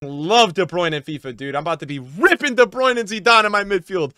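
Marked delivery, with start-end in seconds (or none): none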